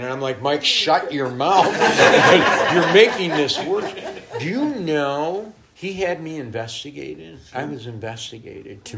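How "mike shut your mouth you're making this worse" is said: half-laughing mock scolding, a bit louder; laughing rebuke. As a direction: laugh through a playful shut-up. Retold quarrel, laughing.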